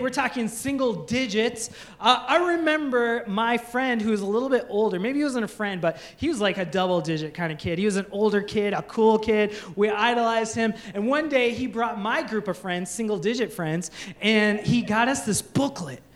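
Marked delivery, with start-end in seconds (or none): none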